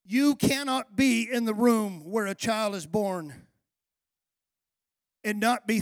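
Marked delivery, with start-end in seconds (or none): none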